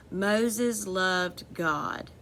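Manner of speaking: very slow, each word spaced; slow dictation pace; slow read-aloud sentence. Shown as a demonstration. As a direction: read it out slowly for the class. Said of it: "Is that a question or telling".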